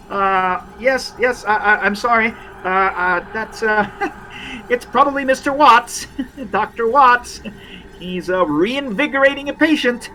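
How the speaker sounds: acted awkward, giggly cover-up; nervous nurse. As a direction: stall and apologise as a flustered nurse. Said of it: "nurses look at each other nervously".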